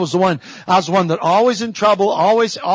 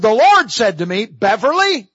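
imitated divine call, pitched up; calling by name; 3 semitones higher than usual. Mother retells the Lord calling her, calling out.

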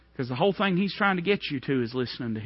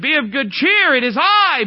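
high, loud proclamation of comfort; ringing call; 11 semitones higher, 10 dB louder than usual. Acted. Jesus calling to the frightened disciples, loud and high. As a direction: call it out over the wind. Jesus calls across the storm.

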